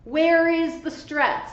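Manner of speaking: ordinary delivery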